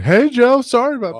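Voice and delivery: high, loud mock coach apology; imitating the coach. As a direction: belt it out as the fired-up coach. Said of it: "He said".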